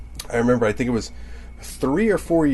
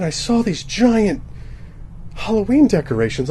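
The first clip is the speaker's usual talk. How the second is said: normal talking voice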